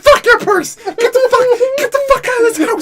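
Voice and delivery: very high, loud exasperated screaming; frantic yelling. Retold or imitated. Son retelling his orders to a dawdling mom, panicked yell. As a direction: scream the order as if time has run out.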